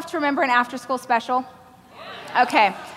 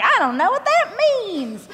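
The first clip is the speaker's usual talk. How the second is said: clueless; 9 semitones higher than usual. Retold or imitated. Confused country mouse quote.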